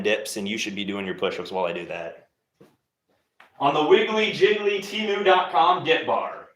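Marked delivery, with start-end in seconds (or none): 3.6-6.5 mock ad voice. Mocking his cheap dip bar.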